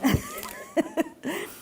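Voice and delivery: bright high burst of laughter. Real, not acted. Teacher laughing at the end of the fun book.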